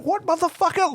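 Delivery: squeaky high mock outburst of confusion; hammed-up high-pitched what; outsider's outburst. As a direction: shriek it like a bewildered foreigner. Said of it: "he's like".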